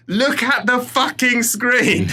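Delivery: high, loud, slow mock yell; half-laughing high yell, drawn out. Son retelling a frantic text to his mum, high mock yell. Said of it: "I type".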